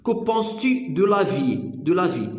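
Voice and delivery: very slow, careful French read; slow French model. Shown as a demonstration. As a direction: model the phrase slowly for the class. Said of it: "كو بونس تو دو".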